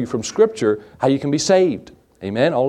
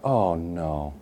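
low murmured oh no under the breath; half-swallowed dread at the noise; quiet dread; 8 semitones lower, 8 dB quieter than usual. Retold or imitated. Pastor retelling hearing a rat under the bed, low and hushed.

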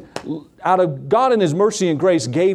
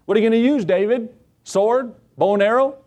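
higher, teasing big-brother taunt; mocking older brothers; 6 semitones higher than usual. Brothers taunting young David, mocking and higher. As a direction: needle the little brother about his weapon.

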